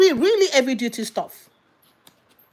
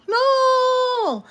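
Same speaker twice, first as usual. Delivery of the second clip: emphatic shout; 13 semitones higher than usual. Real, not acted. Preacher shouting an answer to her own question, emphatic.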